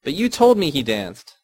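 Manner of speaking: indignant protest at mother; angry child. A narrator's reading. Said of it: "said angrily".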